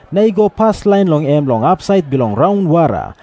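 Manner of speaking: ordinary delivery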